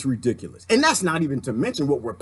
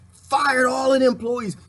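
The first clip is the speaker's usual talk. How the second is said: outraged shout; 8 semitones higher, slower than usual. Real, not acted. Vlogger rails at Amazon firing workers, shouting. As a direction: hurl it out in disgust.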